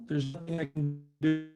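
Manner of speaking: ordinary talk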